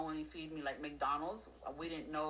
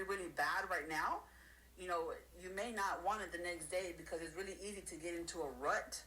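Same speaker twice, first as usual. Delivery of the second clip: usual speaking voice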